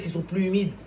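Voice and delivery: impassioned Friday sermon at full force; fiery preaching. Acted. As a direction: preach it hard to the congregation. Preacher exhorts the congregation.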